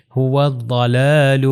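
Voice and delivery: هو الضلال very slowly as "huwad-dalaalu"; هو الضلال with long held vowels and heavy daad; heavy in-between daad. Shown as a demonstration. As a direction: recite هو الضلال drawn out, d thick, between dal and zal. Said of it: "دونوں کی درمیانی والی حالت اور موٹا".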